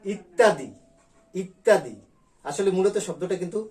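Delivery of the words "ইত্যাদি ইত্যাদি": ইত্যাদি said louder with an open এ start; teacher showing everyday speech; demo pronunciation. Shown as a demonstration. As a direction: say ইত্যাদি as spoken, opening with এ. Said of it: "আমরা বলি".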